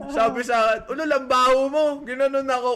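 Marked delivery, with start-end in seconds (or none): 0.9-1.9 louder, higher mock-irked comeback; retold annoyed ghost. Retelling the ghost's insult to him, mock-annoyed and louder. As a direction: snap back as the ghost, half in fun. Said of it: "Siguro napikon yun".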